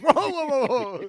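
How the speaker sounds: high, loud startled protest; mock alarm. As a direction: stop them short, laughing at the end. Acted alarmed reaction to crude remark.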